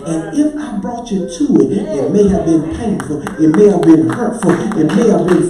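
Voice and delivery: loud, driving, building pulpit cadence; swelling preacher's roar of assurance; full-force preaching. Real, not acted. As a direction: preach it in surging waves of rhythmic phrases.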